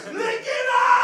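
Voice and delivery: maniac scream, very high and loud; dad screaming. Retold or imitated. Dad screaming at the sons to lick it up. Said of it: "My dad is screaming like a maniac".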